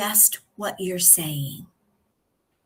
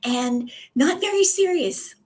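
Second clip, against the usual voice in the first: pitched up high to show a thin, unserious voice; raised-pitch demo of a weak voice; 8 semitones higher than usual. Shown as a demonstration. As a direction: lift the voice up high and let it go thin. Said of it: "speaking up here".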